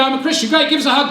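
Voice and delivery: upbeat, friendly hug offer; cheerful invitation. Pretend. Pastor showing how Christians should greet each other, cheerful. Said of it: "people go".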